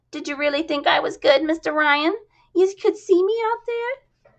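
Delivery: high, eager, squeaky voice; squeaky chorus girl. Acted. Jasmine asking Ryan for praise, squeaky and eager. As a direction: fish for praise, squeaky and hopeful. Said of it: "rather squeakily grating".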